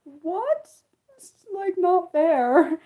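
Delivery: high-pitched, plaintive complaint; mock whiny protest. Retelling her reaction to British voice notes, high mock whine. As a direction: whine it in a high, envious squeal.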